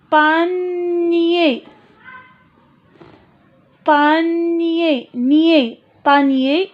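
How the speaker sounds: panier split into pa and nier; syllable clapping. Shown as a demonstration. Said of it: "deux syllabes".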